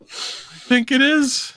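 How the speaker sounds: very high, squeezed through laughter; real laughing fit. Real, not acted. Co-host cracks up at the podcast joke, laughing hard. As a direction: try to talk while cracking up.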